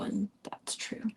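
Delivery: slow unvoiced whisper. Real, not acted. Reader admitting to listeners, slow whisper. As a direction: hush it slowly.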